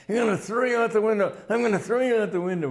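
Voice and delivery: high, fast, furious bully's yell; livid threat. Retold or imitated. Older bully beating up a thin boy, shouting high and fast. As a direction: spit out the threat like a raging teenage bully. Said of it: "just in livid fury".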